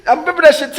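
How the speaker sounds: ordinary talk, nothing marked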